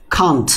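"Can't" with a breathy kh at the start; aspirated can't. Shown as a demonstration. Teacher models the contracted can't. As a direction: puff of air on the k of "Can't". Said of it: "সংক্ষেপে আমরা এটাকে কি বলি".

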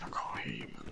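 whisper. Retold or imitated. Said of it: "I said".

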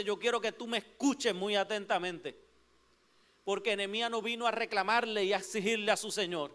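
ordinary talk, nothing marked

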